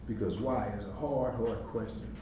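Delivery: ordinary delivery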